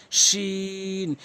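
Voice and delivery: "শিন" with a long held vowel; drawn-out letter. Shown as a demonstration. Teacher demonstrating, long level "শিন". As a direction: hold the "ee" of "শিন" on one note. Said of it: "জিহ্বার মাঝখান থেকে উচ্চারণ হয়".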